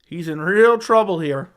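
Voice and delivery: lightly imitated fight commentator, loud and drawn out; hint of a commentator's loud, slow call; Joe Rogan. Streamer mimicking a commentator for chat, lightly put-on commentator voice. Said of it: "doing his best impression of Joe Rogan".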